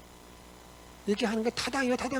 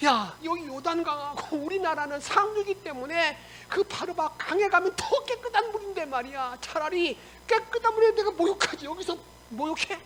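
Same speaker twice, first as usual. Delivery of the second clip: higher-pitched indignant rant; acted angry grumbling; 7 semitones higher than usual. Naaman objects to bathing in the Jordan, acted indignant grumble. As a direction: grumble scornfully in mock temper. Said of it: "막 화를 내면서 막 돌아가려고".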